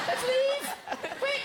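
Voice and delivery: high, laughing through words. Real, not acted. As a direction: giggle the words out. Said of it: "joking, laughing".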